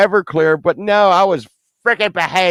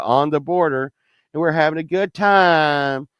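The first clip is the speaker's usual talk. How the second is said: ordinary delivery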